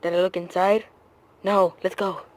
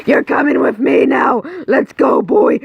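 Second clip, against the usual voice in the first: higher, loud commanding shout; yelling at the dog; 6 semitones higher, 3 dB louder than usual. Acted. Owner grabbing the lost dog, loud shout. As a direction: bark the order at the dog.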